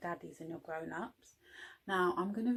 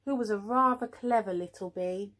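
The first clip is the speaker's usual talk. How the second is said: usual speaking voice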